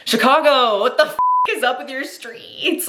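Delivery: high, exasperated outcry at the city's streets; mock scolding. Real, not acted. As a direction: tell Chicago off for its potholes. Scolding the city after bumpy ride.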